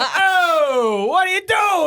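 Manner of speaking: high laughing yell; laughing shout. Retold or imitated. Retort retold laughing.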